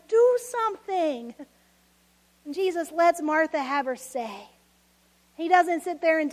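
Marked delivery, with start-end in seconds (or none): 0.1-1.4 slow, higher demand. Shown as a demonstration. Preacher showing how to pray to Jesus, slow and higher. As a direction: spell out the plea. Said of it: "Tell him sometimes".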